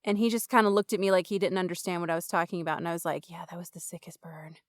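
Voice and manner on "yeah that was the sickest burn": very soft, nearly whispered reply; muttered aside. Retold or imitated. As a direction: mutter it proudly under your breath. Retelling smug aside after insult.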